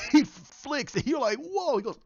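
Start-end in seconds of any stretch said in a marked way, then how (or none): 1.4-1.8 high amazed gasp of a whoa. Retold or imitated. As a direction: let it fly up in awe. Reacting to the flick.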